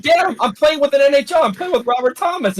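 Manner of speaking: high, giddy brag to dad; bragging excitedly. Retold or imitated. Charles telling his dad about Robert Thomas, high giddy brag. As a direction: boast like a kid. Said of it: "He was like".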